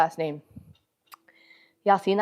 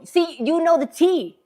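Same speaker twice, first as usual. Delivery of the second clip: higher, louder, slow and sassy; sassy knowing; 10 semitones higher, 6 dB louder, slower than usual. Real, not acted. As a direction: drag it out, full of attitude. Sassy reply to a commenter.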